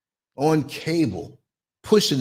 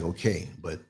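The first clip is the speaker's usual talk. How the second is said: stern father quietly; 10 semitones lower, 9 dB quieter than usual. Retold or imitated. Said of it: "he was like".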